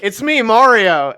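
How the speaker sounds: big, loud, drawn-out game catchphrase; booming video game intro voice; Chris Pratt as Mario. Acted. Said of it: "it's just Chris Pratt's voice".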